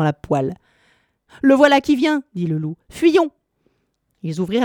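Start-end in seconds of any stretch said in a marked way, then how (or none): 1.4-2.2 much higher, louder panicked cry; frightened wolf. Acted. Wolf panicking as the devil comes down, higher cry. 2.9-3.3 very high, louder shriek of "Fuyons"; panicked flight. Acted. Wolf urging the beasts to flee, high panicked shriek.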